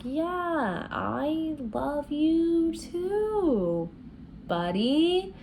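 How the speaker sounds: friend-zoning drawl; awkward reply. Retold or imitated. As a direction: let the buddy land as a letdown. Evangeline awkwardly answering Apollo.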